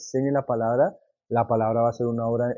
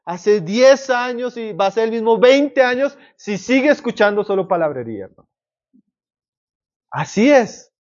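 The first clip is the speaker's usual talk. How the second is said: raised, fervent preacher voice, higher and louder; preaching; 7 semitones higher, 4 dB louder than usual. Acted. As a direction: preach it out to the congregation with conviction. Preacher rising again to a climax.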